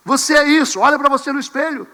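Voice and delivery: a hint of a scolding pastor; mock preacher. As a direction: point at them like a harsh preacher. Preacher voice confronting with mirror.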